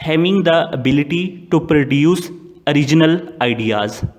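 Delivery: very slow, word by word, as if dictating; slow dictation. Shown as a demonstration. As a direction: spell out each word for students to write. Dictating a definition slowly. (धीरे-धीरे लिखवाते हुए)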